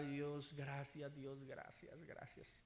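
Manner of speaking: very soft, fading to a whisper; acted quiet relief, barely voiced; hushed thankful prayer. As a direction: murmur thanks under the breath, trailing away. Reenacts thanking God after near accident.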